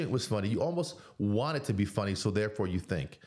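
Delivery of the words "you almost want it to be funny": ordinary delivery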